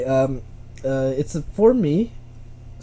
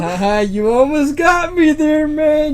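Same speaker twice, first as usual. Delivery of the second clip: laughing; 10 semitones higher, 5 dB louder, slower than usual. Real, not acted. Conceding the trick, laughing.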